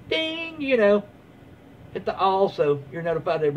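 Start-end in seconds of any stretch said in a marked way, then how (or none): 0.1-0.6 high sung ding like a bell; mock bell ding. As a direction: chime the bell sound. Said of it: "hit the little bell".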